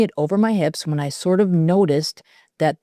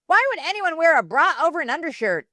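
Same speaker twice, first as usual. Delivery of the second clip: higher, sneering voice; mocking taunt; 11 semitones higher than usual. A narrator's reading. Mean girl sneering at Lainey an inch from her face.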